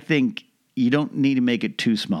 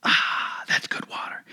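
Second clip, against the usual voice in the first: breathy whispered ah after a gulp; satisfied sigh after drinking; 4 dB quieter, slower than usual. Real, not acted. Comic telling his audience to drink water, whispered refreshed sigh. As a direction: let out a soft whispered ahh of refreshment. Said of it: "I'm gonna have some water right now".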